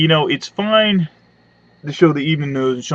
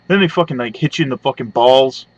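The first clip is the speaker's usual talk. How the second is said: ordinary talk, nothing marked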